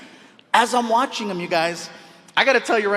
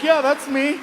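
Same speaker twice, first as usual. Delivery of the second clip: higher, modest little admission; feigned composure; 7 semitones higher than usual. Retold or imitated. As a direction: play it cool while secretly thrilled. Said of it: "Outside, I'm like".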